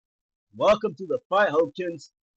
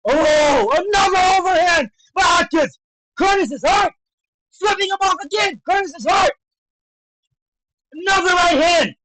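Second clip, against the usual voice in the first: high-pitched live fight call; excited shouting; 8 semitones higher, 4 dB louder than usual. Real, not acted. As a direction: shout out each punch as it lands. Calling big punches live.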